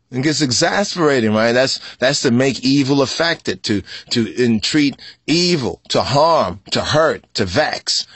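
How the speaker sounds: ordinary delivery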